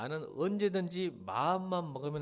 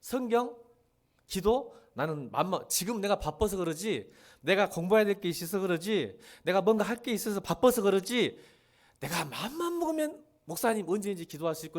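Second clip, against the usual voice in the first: put-on louder, higher cocky young man brushing off the pastor; boastful excuse-making youth, louder and higher; mock stubborn youth; 3 semitones higher, 4 dB louder than usual. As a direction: play the brash young believer making excuses. Preacher acting a stubborn youth.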